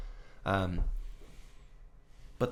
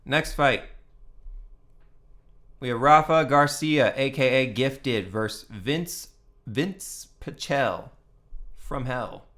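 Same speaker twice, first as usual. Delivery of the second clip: announcer intro; 3 dB louder, slower than usual. Acted. Announcing the next fight card.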